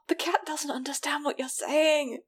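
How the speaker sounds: soft whispered aside. Real, not acted. Cohost explaining the cat can't understand, whispered. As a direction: drop to a breathy whisper.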